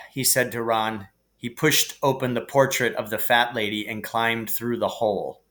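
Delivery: usual speaking voice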